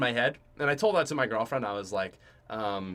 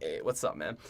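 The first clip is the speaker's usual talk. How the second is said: quiet casual greeting; 11 semitones lower, 10 dB quieter than usual. Retold or imitated. Greeting him with finger guns.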